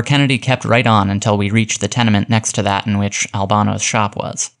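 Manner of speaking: ordinary delivery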